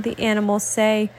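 ordinary delivery, nothing marked

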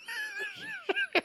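high, wheezing laugh that keeps catching; engine-like laugh. Real, not acted. Comedian cracking up at own lawyer voice, wheezy stuttering laugh. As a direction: laugh like an engine that won't start. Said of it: "I sound like an engine that won't start".

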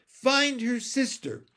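high, loud urgent instruction; calling out. A narrator's reading. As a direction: shout advice from the crowd. Child calling out from crowd.

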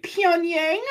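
squeaky high goofy voice for the answer; a dumb student's high-pitched goofy answer. Retold or imitated. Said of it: "we'd be like".

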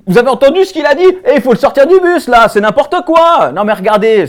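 loud, high protest to everyone around; outraged appeal. Acted. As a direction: call the whole bus to witness, scandalised. Le passager prend le bus à témoin. (indignation criée à la cantonade)

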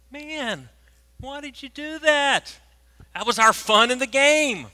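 mock-aggrieved high whine, building to a loud cry; imitated whiny protest. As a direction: wail like fans robbed of their fun. Acting fans' protest at spoilsport.

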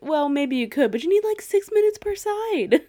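high, loud, laughing protest; ranting about a fifteen-minute steak; incredulous rant. Retold or imitated. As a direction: rant it in cracking disbelief. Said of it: "And I'm like".

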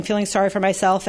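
ordinary delivery

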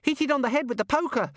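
put-on very high, eager child's voice; high-pitched gleeful child; excited little boy. A narrator's reading. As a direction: egg her on like a bloodthirsty small boy.

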